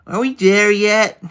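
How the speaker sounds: put-on high, slow whining kid voice; whiny kid. Streamer whining at the drawing like a kid, high and slow. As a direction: drag it out like a bored child in the car.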